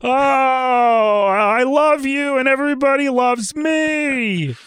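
high, slow Ray Romano voice; drawn-out sentimental grandpa voice; Ray Romano impression. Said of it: "I just start doing Ray Romano".

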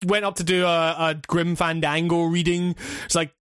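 usual speaking voice

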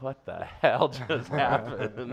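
slow laughing what the hell; laughing disbelief. Retold or imitated. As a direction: let the laugh break through. Quoting CEO's reaction.

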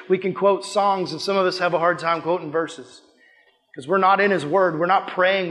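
ordinary talk, nothing marked

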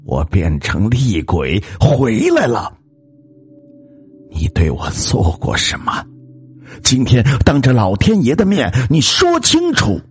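vengeful ghost, climbing to a high shriek; shrill, frightening accusation. Acted. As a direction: confront your killer as a terrifying ghost. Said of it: "声音凄厉而骇人". (凄厉骇人地厉声质问)

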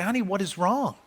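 soft, gentle question; soft worried-parent question; tender parent. Retold or imitated. Parent comforting a worried child.

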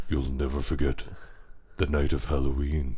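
low, ominous trailer narrator; deep voiceover for an eighties movie trailer; horror trailer voice. Retold or imitated. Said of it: "movie trailer voiceover".

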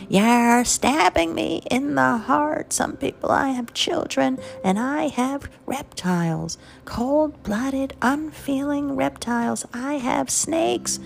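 high, slow melodramatic scream of a wounded mother. Retold or imitated. Mother accusing her children of being cold reptiles, high dramatic wailing. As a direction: wail it like a guilt-tripping mother. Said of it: "my mother screamed".

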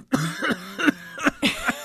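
choking cough; a real choking cough; coughing fit. Real, not acted. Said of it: "went down the wrong pipe".

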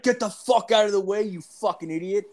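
dad yelling at a guy blocking his car. Retold or imitated. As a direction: bark it like an old man losing it. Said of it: "My dad's like".

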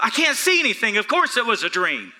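high, loud mock exasperation; retold self-correction. Blind preacher retells correcting himself, mock exasperated. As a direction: remind yourself of the obvious, loudly. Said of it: "had to remind myself".

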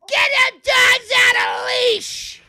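high shrill-pitched shout; mock screech at a dog owner; old lady yelling. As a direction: yell it like a furious old park lady. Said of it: "and I just hear".

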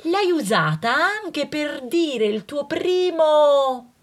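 ordinary talk, nothing marked

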